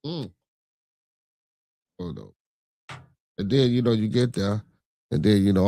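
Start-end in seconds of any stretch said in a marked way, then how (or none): none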